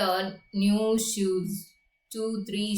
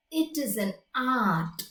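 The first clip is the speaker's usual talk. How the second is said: put-on old German shoemaker's accent on It is an art, lightly done. Shown as a demonstration. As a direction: say It is an art like a German immigrant craftsman. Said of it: "It is a German accent".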